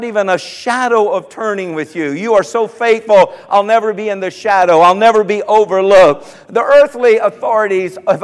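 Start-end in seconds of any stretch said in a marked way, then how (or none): none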